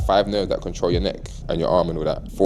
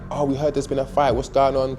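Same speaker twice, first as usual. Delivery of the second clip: imitating police; 7 semitones higher than usual. Police arrive asking questions.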